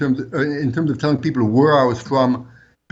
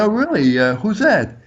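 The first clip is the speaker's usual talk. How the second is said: mock innocent surprise; 10 semitones higher than usual. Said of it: "I said".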